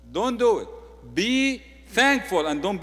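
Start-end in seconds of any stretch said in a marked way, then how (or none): 0.1-0.7 loud warning. Shown as a demonstration. Pastor warning a grumbler, firm and loud. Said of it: "[name], don't do it".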